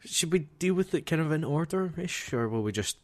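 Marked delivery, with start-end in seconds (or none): none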